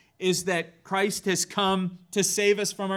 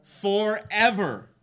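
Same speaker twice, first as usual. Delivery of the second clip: slow, stretched "Forever" landing hard; drawn-out emphasis; 3 semitones higher, 4 dB louder, slower than usual. Real, not acted. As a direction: let the word ring out. Answer to how long he reigns.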